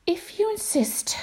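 high whispered reply; whispered elephant voice. Acted. Elephant answers.

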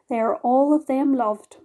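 ordinary delivery, nothing marked

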